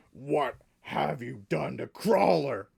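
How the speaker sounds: loud, gruff Southern bellow; an orc roaring with a rough Southern accent; gruff Colonel Sanders accent. Acted. GM as orc finding his friend Crawler, gruff Southern outrage. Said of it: "Colonel Sanders' gruff accent".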